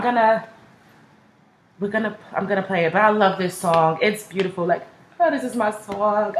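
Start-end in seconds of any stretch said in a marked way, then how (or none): none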